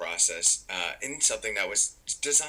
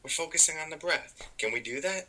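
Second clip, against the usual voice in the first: gentle with the mind; 6 semitones higher than usual. Retold or imitated. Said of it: "be gentle and we wanna say".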